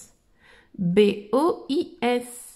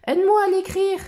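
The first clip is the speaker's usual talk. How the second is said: put-on bright, high child explorer voice; Dora voice; 7 semitones higher, faster than usual. Reading Dora's lines to little listeners, in a high cheerful voice. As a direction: lift the voice like Dora talking to kids.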